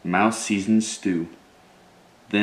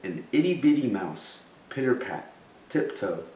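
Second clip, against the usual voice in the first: ordinary delivery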